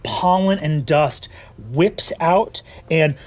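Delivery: usual speaking voice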